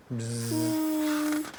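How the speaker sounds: high buzzing bee. Pretend. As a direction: buzz like a bee.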